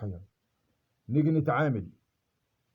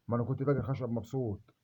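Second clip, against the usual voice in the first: ordinary delivery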